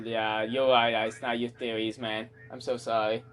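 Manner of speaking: low, deadpan mock apology; insincere, low-pitched sorry; sarcastic apology. Real, not acted. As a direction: concede with dry sarcasm. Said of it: "That was sarcasm".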